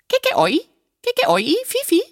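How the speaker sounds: very high, short bird calls; bird language. A narrator's reading. Parrot shows the doctor bird language, high chirping. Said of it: "Das heißt in der Vogelsprache".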